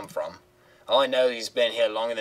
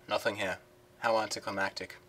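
ordinary talk

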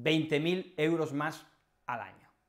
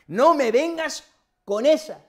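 high, loud "No me vengas con esas"; exasperated rebuke; 9 semitones higher, 11 dB louder than usual. Retold or imitated. To someone making excuses, a loud exasperated rebuke. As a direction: slap down the excuse hard. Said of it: "Eso es lo que yo le digo".

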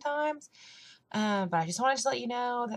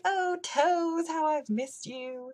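mock delight; 9 semitones higher, much slower than usual. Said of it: "And I'm like".

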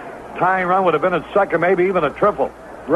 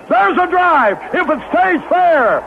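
excited call; 10 semitones higher, slower than usual. Real, not acted. Announcer calling a long drive, excited. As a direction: call the drive as it carries toward the line.

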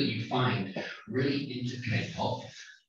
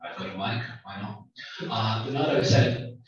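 ordinary delivery

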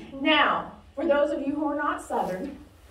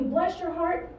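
softer, higher grandma voice; lightly voiced Southern grandma, softer and higher; sweet grandma; 6 semitones higher, 6 dB quieter than usual. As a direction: coo it like a praying grandmother. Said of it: "like Grandma talking to you".

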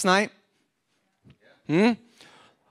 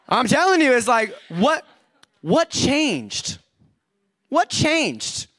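ordinary delivery

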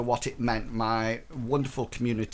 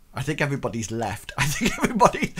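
fast, laughing aside; much faster than usual. Real, not acted. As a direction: laugh through it. Laughing at empty room.

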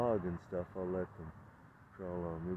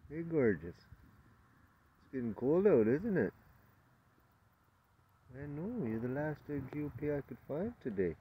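cooing to a spider; 8 semitones higher, 5 dB louder than usual. Real, not acted. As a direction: coo at her like a pet.

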